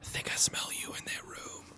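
leaning close, whispered. Acted. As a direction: whisper it into her ear. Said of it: "I'll lean over to Jenna and go".

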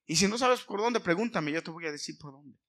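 lightly voiced God urging them to go; voicing God. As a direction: call the listener onward as God. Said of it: "Y Él te dice".

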